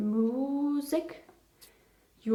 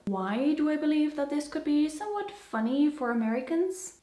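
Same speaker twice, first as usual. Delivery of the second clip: ordinary talk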